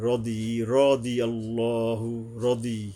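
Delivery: রদি with tongue edge to the teeth, slow; careful dwad. Shown as a demonstration. Teacher drills the dwad sound in রদি, slow. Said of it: "জিব্বার কিনারা মেশবে".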